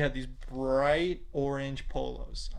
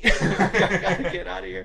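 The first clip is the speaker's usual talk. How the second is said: laughing, louder and higher; cracking up while telling the escape; 6 semitones higher, 9 dB louder than usual. Real, not acted. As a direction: blurt it out through laughter. Fleeing with twenty bucks.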